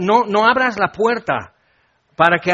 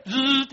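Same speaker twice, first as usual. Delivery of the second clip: high-pitched buzzing noise; mimicked buzzer; doorbell buzz; 7 semitones higher, 3 dB quieter than usual. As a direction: imitate the doorbell cutting in. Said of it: "el timbre".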